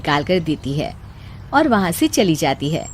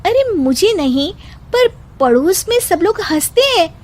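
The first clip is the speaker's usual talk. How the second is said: put-on higher young bride voice, patiently scolding; higher daughter-in-law warning of ridicule; daughter-in-law, reasoning; 7 semitones higher than usual. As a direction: warn her mother-in-law kindly. Wife complains to husband.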